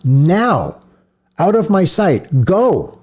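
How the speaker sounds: high, loud furious shout. A narrator's reading. Priest shouting the silent child out of his sight, loud. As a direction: drive him away at full voice. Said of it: "Again Mahler shouted, louder".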